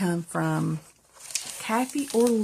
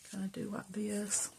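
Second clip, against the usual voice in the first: whispering; 12 dB quieter than usual. Real, not acted. Whispered while working.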